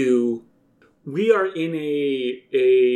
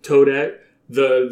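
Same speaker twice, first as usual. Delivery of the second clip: usual speaking voice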